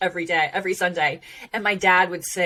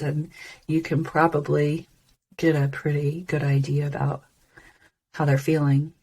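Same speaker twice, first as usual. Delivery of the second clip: low-energy downcast demo; 4 semitones lower than usual. Shown as a demonstration. Coach showing listeners how feeling shows in the voice, low and subdued. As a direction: let the voice sink as if feeling down. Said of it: "They can talk to you like this".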